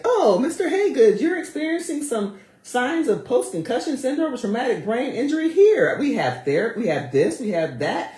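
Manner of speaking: high, sugary, overly eager helper voice; a sweet, high salesman promising to take care of everything; mock caring NFL rep. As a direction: play a fake league official fawning over the player. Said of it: "misconception that the N F L is, like, actively like".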